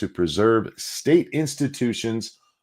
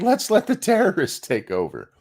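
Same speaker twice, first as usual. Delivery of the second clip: mocking quote; 10 semitones higher than usual. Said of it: "They, they were like".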